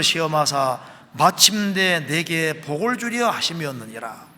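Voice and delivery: loud, slow congregational Bible reading; scripture reading. Acted. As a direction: lead the congregation through the verse, forcefully. Leads reading of verse sixteen. (크고 느리게 힘주어 성경 봉독)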